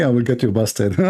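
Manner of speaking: usual speaking voice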